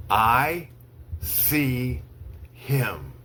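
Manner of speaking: slow, one word at a time with long pauses; slow dictation. Shown as a demonstration. Teacher reads to students for dictation, slow, word by word. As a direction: read it word by word so they can write. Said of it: "I'll say each sentence slowly three times".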